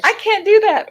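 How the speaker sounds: high, loud mock protest; exaggerated squealed refusal; playful protest. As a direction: refuse in a big, high-pitched voice. Acted refusal to nosy wife.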